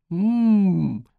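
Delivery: long high hum of delight; high appreciative hum; savouring "mmm". Acted. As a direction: hum it high over delicious food. Boy savouring a spoonful of pudding.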